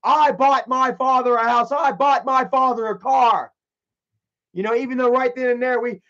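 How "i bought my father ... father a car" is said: high, loud mock boast; imitated boasting Michael. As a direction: proclaim it like someone bragging about gifts. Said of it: "Michael loves to bring up".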